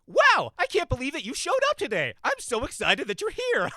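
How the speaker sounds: very high, shouted voice-over gushing; hammed-up squealing welcome ending in loud laughter; over-the-top fake excitement. Comic mimicking a voice-over reference recording, wildly over-excited. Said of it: "what they want it to sound like".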